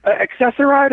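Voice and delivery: hint of a questioning lady; lady with a cane. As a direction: lift the pitch a little for a retold lady's question. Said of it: "a lady comes out with a cane".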